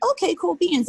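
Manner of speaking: chirpy little self-satisfied quip; pleased. Retold or imitated. Telling the host about losing thirty pounds, upbeat and pleased. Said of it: "And I'm like".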